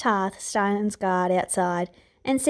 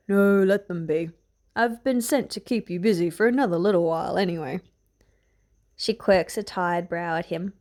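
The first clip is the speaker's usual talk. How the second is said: lightly lowered gruff voice; a mild rough old soldier; a hint of a gruff man; 3 semitones lower than usual. A narrator's reading. Guard speaking to Brienne, lightly gruff. Sandor's held voice.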